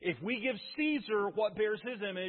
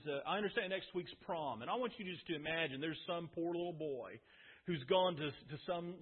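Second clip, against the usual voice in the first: normal talking voice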